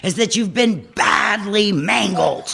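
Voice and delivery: half-laughing imitation of a gruff old-timer; ribbing the old man by quoting him; mock gruff sponsor. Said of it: "and he'd say".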